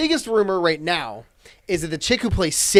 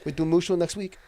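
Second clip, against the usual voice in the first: clumsy put-on Spanish accent; mock Spanish-sounding accent. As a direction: lightly fake a Spanish accent, badly. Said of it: "the Chinese people sounded Spanish".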